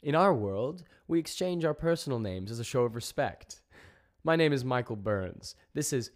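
loud, high, formal introduction; stern diplomat projecting over a crowd; stern address. A narrator's reading. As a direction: address them firmly and respectfully, projecting. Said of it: "cut through his stern address".

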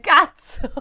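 high, loud curse; exclaimed. Real, not acted. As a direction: blurt it out. Reacting to correction. (imprecazione forte e acuta)

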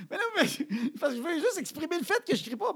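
high, strained, pleading denial; comic desperation while insisting it is calm. Retold or imitated. Father insists he isn't yelling, high worked-up denial. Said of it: "j'ai juste élevé le, le, le, le pitch".

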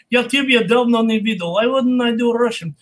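strong Russian-accented delivery. Shown as a demonstration. Showing a student some Russian, Russian accent. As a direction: speak Russian, then English with a Russian accent. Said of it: "Do some Russian accent".

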